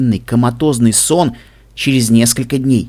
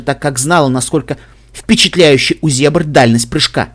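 plain reading voice

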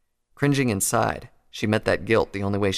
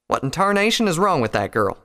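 raised-pitch country exasperation; snapping; 7 semitones higher, 4 dB louder than usual. A narrator's reading. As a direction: snap in irritation, country-style. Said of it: "Applejack snapped".

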